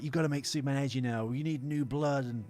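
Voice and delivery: ordinary talk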